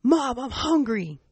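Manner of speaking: put-on high, slow whine of a hungry little girl; whiny hungry kid. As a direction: drag it out like a tired, starving child. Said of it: "here's Afia".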